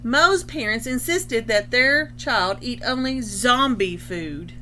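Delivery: usual speaking voice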